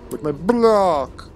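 playful, drawn-out war cry; hammed-up battle cry. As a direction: stretch it out like a pretend hero, swelling on the last word. Repeats mock-heroic battle cry during gameplay.

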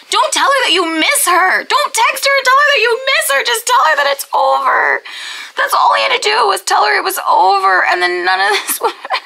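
high-pitched, insistent pleading rant; exasperated rant. Real, not acted. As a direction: scold the character in exasperation.

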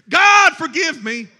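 loud, high cry for mercy, hammed up; a sinner's last-second begging, played big; mock desperate plea. Acting sinner's last-minute plea.

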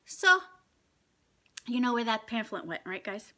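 ordinary talk, nothing marked

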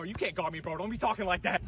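ordinary talk, nothing marked

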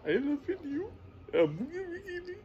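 high, put-on Indian accent. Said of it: "the Indian voice".

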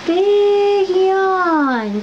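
cooing to cat. Real, not acted. Owner cooing at her yawning cat, high baby talk. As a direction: marvel sweetly at the cat's yawn.

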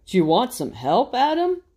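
a patient lion king offering help; gently voiced king.

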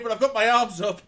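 laughing. Real, not acted. Celebrating a successful extraction.

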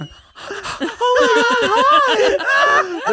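high, loud, breathless dog voice; hammed-up pet greeting, broken by pauses; excited dog. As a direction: pant the words out as an overjoyed dog. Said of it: "The dog's like".

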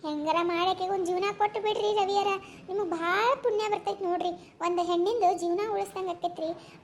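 usual speaking voice

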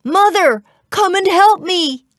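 high, loud cry for help; shouted. A narrator's reading. Jack flees the giant, shouting for help. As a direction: yell urgently for mother. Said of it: "He shouted".

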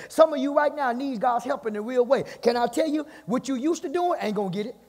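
ordinary talk

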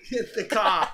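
slow laughing Boston Get the car, r dropped; bad Boston accent. Admits he can't do accents, laughing bad Boston. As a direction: laugh then stretch out a clumsy Boston order. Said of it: "I don't know how to do this".

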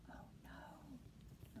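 gasped, very soft whisper; hushed dismay. Acted. Reader reacting to the lost pearl, hushed dismay.

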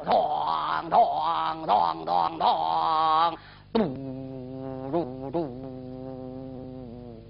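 slow, low booming drum mimicry; imitated drums and horns. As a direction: mimic war drums then a horn. (模仿鼓号声)

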